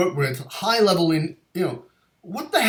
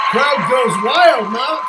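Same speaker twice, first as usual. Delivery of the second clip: louder, higher, slow hype call; hyped announcement; 7 semitones higher, 7 dB louder, much slower than usual. Acted. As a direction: sell it like the crowd is roaring. Host hyping guest entrance.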